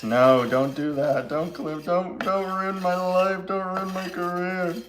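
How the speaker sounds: high, slow, hammed-up begging; mock pleading. To viewers who might clip it, mock pleading. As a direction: beg dramatically, half joking.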